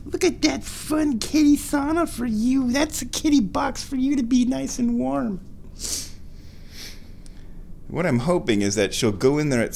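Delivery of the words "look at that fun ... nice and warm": very high sing-song pet voice. Real, not acted. Baby talk to the cat.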